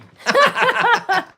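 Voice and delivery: big high burst of laughter; loud laugh. Real, not acted. Viewer comment about bad dubbing.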